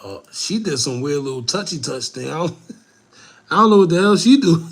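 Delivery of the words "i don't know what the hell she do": laughs through it, louder and fast; can't keep a straight face; laughing. Real, not acted. Laughing at the touchy touch while watching the funeral.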